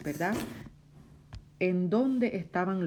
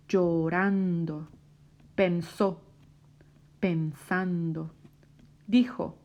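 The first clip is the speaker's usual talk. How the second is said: read-along word list. Shown as a demonstration. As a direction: enunciate each verb for a learner reading along. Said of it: "Quiero que la leas conmigo".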